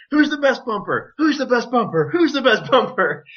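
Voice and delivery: high, mocking taunt, asked over and over; taunting bully. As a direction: needle him like a gym bully. Taunting while crushing him on bench.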